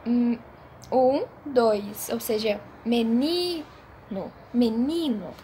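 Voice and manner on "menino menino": menino with NI as the strong syllable; stress on NI. Shown as a demonstration. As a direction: repeat menino, weight on NI. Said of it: "A nossa sílaba mais forte é o ni". (menino com NI tônico)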